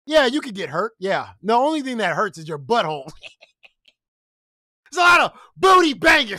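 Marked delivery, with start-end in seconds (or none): 4.9-6.4 loud, high, half laughing; real laughing outburst; laughing exclaim. Real, not acted. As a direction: blurt it out cracking up. Laughing exclaim about the topic.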